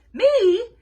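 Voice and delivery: high, loud startled yell. Acted. Bean yelling at Ivy in shocked disbelief, loud high yell. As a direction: shout it out in shock. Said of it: "yelled Bean".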